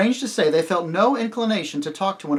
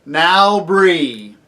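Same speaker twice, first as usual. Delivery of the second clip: loud, commanding summons; louder lion voice; 4 semitones higher, 13 dB louder than usual. A narrator's reading. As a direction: call out with authority. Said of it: "spoke in a louder voice".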